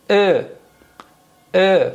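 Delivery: "ا" with jaw forward, each one isolated; stretched-lip "ا", between e and o; jaw-forward vowel. Shown as a demonstration. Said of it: "فک میاد جلو". («ا» با فک جلو و لب کشیده)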